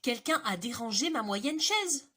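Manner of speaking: mid-sized bear, firm and loud; middle bear voice. Acted. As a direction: medium bear complaining about her chair. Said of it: "d'une moyenne voix".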